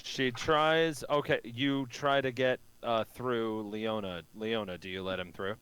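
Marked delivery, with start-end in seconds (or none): none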